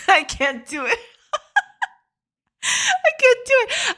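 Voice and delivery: helpless giggles, voice squeaking high; real laughing. Real, not acted. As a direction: crack up while trying to get the words out. Cracks up attempting the accent.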